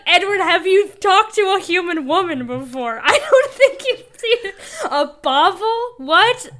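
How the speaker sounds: high, loud, laughing disbelief; incredulous scolding. Acted. Podcast host tells off a clueless old vampire, incredulous.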